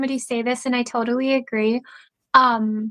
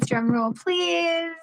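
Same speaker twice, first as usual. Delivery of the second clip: playful announcement; 5 semitones higher, 5 dB quieter, much slower than usual. Pretend. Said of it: "announcing the answer".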